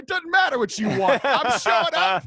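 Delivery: high, loud, emphatic retort; insistent, acted. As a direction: play an overriding God who won't take no. Acting out God insisting on coming.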